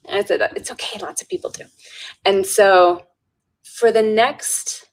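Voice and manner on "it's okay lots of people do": quick, hushed reassurance; retold whisper. Helper reassures the doubting guard, whispered. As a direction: whisper it back lightly and quickly. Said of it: "and I whispered".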